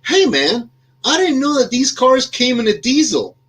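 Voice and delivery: high, bright mock voice of a young guy; young Goodwill worker voiced high and amused; young man's voice. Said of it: "the young man who came out said".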